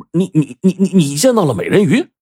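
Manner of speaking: so stunned the words tumble out. Retold or imitated. Narrator asks the villager about mermaids, stammering in shock. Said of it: "我当即整个人就愣住了".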